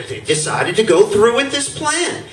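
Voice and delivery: usual speaking voice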